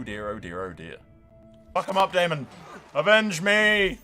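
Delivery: ordinary talk, nothing marked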